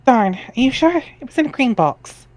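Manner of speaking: put-on perky girl reading her chat lines up high; Jade's chat voice.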